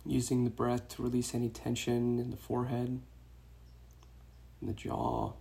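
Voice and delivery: soft meditation voice with long pauses; guided meditation. Real, not acted. Meditation teacher guiding runners to release tension, soft guided-meditation voice. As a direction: guide them gently into the breath, hushed and unhurried.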